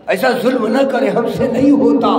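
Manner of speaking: pleading companions. Acted. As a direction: beg him not to. Companions protest.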